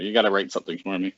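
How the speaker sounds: ordinary delivery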